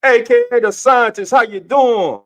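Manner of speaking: high, loud announcer shout; hype greeting. Real, not acted. Host greets a viewer joining the show, high hyped shout. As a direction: shout the nickname like a hype man.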